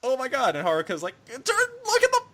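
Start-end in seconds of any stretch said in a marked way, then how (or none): 0.0-0.6 mock shock. Kosaka shocked. 1.3-2.2 very high, loud call to look at the fire; cartoonish shriek about the fire; high mock shout. Haruka pointing at fire.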